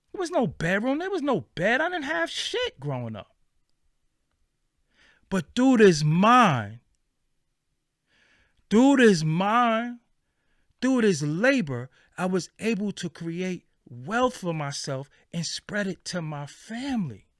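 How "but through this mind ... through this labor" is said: through this mind, stretched slow and hammered loud; slow emphatic build. Real, not acted. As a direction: drive each phrase home like a sermon. Preacher-like emphatic rant.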